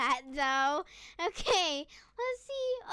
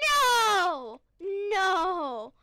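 disbelieving no; 7 dB louder than usual. Real, not acted. Disbelief at second rare pet.

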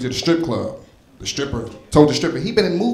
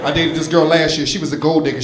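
ordinary delivery

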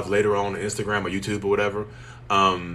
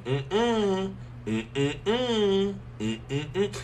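teasing hum; 10 semitones higher, 4 dB quieter, much slower than usual. Acted. Teasing hum, mocking baiters.

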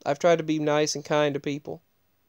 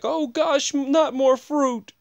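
high, slow mock groan of a fed-up teacher; mock exasperation; 10 semitones higher, slower than usual. Teacher complaining about endless fruit baskets, high, slow mock exasperation. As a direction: whine it out like she's sick of fruit. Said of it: "And she was like".

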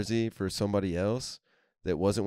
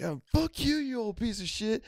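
much higher put-on angry voice; mock furious buddy. Heated buddy cursing out the old Pirates fan, high mock fury. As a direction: hurl the insult in a squeaky rage.

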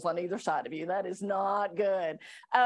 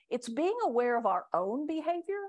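usual speaking voice

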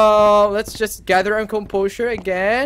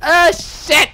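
high, loud yelp of alarm; sudden spooked cry; startled exclamation; 8 semitones higher, 6 dB louder than usual. Real, not acted. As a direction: blurt it out loudly in shock. Startled mid-laugh.

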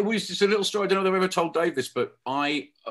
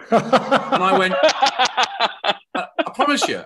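laughing; 6 semitones higher, 4 dB louder, much slower than usual. Real, not acted. Laughing while retelling the argument.

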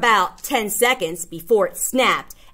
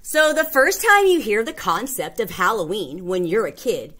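ordinary talk, nothing marked

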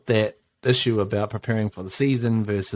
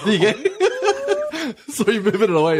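high, laughing words; real laughter; 13 semitones higher, 4 dB louder than usual. Real, not acted. Host teasing a guest about the microphone, laughing. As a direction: crack up while you talk, pitch soaring.

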